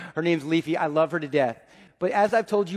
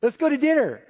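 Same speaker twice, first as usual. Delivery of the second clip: much higher, bright squeal on really; excited dad; 8 semitones higher than usual. Retold or imitated. Dad thrilled his daughter asked him to dinner, squealing excitedly. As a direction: gush with delight at the invitation. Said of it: "And I am so excited".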